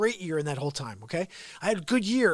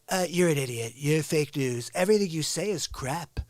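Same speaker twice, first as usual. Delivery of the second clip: lightly voiced Trump, a bit lower; Trump impression; 5 semitones lower than usual. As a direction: drop into a hint of Trump. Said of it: "I just play him".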